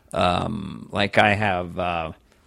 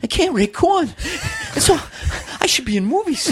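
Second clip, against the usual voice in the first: much higher, dopey mock Wahlberg; high silly Wahlberg voice. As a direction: play him high and dim.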